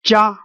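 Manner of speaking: Jia held high and level; high, unwavering Jia; first tone. Shown as a demonstration. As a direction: say Jia on one flat high pitch from beginning to end. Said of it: "make it, the sound is very flat".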